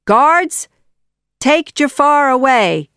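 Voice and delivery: high, loud order; called-out command. A narrator's reading. Sultan ordering guards to seize advisor, loud command. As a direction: summon the guards forcefully.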